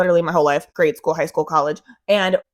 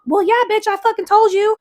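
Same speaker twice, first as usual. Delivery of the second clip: exasperated outburst; 11 semitones higher than usual. Real, not acted. Vindicated outburst at doctors.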